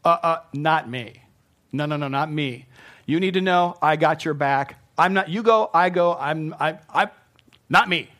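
loud, stammering, over-eager protest; insistent Pete. Acted. Preacher acting Peter swearing loyalty to Jesus, loud emphatic protest. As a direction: protest loudly, tripping over the words. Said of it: "and good old Pete".

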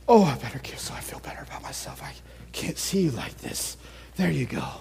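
hushed, hurried guilty whisper; mock whisper. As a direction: whisper it fast like someone buying off guilt. Mocking the world's guilty giver.

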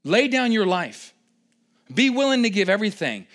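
ordinary talk, nothing marked

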